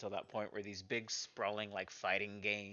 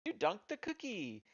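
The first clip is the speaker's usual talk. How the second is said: very high, cheesy game-show style cry; big cheesy narrator; 12 semitones higher, 3 dB louder than usual. Retold or imitated. Game maker narrating a player's win, big cheesy voice. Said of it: "with my big cheesy voice narrating".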